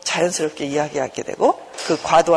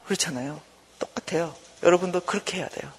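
ordinary talk, nothing marked

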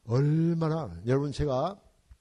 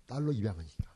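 usual speaking voice

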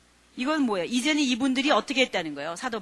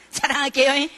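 playful rising "자랑할게요" announcing a boast; teasing boast; 5 dB louder than usual. Retold or imitated. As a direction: tease that he is about to brag. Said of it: "계속 아직 자랑도 못하면서 자랑".

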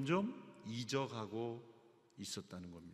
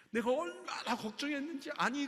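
overwrought mother; 10 semitones higher than usual. Shown as a demonstration. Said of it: "분노도 나고 막 그 안심에 터져 나오는 눈물에".

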